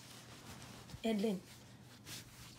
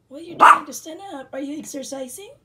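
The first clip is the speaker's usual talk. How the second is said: fast, loud excited squeal; 3 semitones higher, 15 dB louder, much faster than usual. Real, not acted. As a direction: squeal at the puppy. Excited at pet standing.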